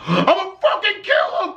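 high mock yell with a laugh; laughing mock scream of rage; retold scream. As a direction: yell it like he's losing it, half laughing. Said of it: "starts screaming at the top of his lungs".

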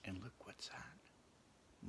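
slow hushed whisper. Real, not acted. Teasing the children about the next animal, slow whisper. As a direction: whisper it slowly, building suspense.

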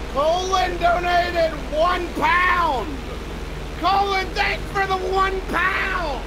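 high, slow announcer gush; mock-grand shout-out for a one-pound donation; hammed-up thanks. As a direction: make a tiny donation sound grand.

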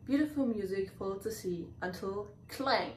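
ordinary delivery, nothing marked

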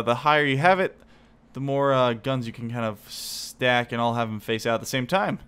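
ordinary talk, nothing marked

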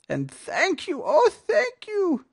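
very high, gushing thanks; grateful cry. A narrator's reading. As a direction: cry out overwhelming gratitude. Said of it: "the grateful witch cried".

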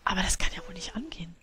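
quick whispered complaint; whispered aside. Real, not acted. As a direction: mutter it under your breath. Muttered aside.

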